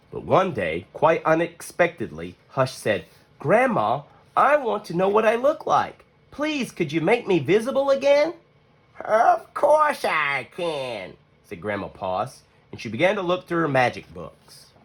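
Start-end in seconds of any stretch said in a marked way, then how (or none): 3.4-8.3 put-on high small pleading child voice; little Hush pleading. Hush begs Grandma to be made visible, pleading high. 9.0-11.1 slow, a hint of a grandmotherly voice; lightly voiced old grandma. Grandma Poss reassures Hush, slow lightly voiced grandma.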